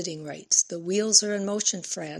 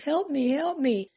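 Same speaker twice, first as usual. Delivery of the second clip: mock plea; 8 semitones higher than usual. A narrator's reading. Mocking plea, eyes rolling.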